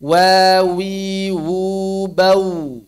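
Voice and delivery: Wa wi wu bau very slowly; drawn-out drill of Wa wi wu bau; slow model reading. Shown as a demonstration. (Wa wi wu bau dibaca sangat lambat)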